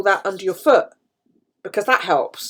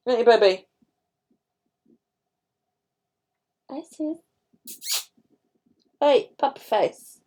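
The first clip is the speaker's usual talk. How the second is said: pet talk; 5 semitones higher than usual. Real, not acted. Talking fondly to her old dog, high cooing.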